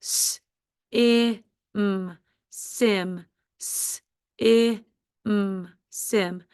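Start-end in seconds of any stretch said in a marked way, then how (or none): 0.0-2.2 S-I-M as separate sounds "sss, ih, mm"; sounded out. Shown as a demonstration. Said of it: "Do the first syllable". 3.6-5.7 S-I-M as separate sounds "sss, ih, mm"; sounded out. Shown as a demonstration. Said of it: "Do the first syllable".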